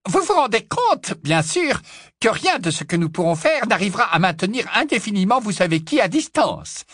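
high squeaky little wizard. Acted. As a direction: squeak it out anxiously. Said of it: "couina le professeur Flitwick".

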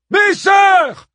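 high, loud rallying cry. A narrator's reading. Hippodamie calls to the women of Troy, crying out. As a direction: call out to a crowd at full voice. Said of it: "s'écria Hippodamie".

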